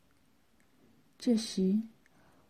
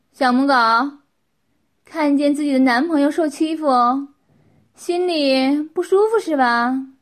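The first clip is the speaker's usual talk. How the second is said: loud, higher-pitched mocking question; loud taunt; 4 semitones higher, 10 dB louder than usual. Qin needles a humiliated onlooker, loud taunting. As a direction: taunt them, voice raised.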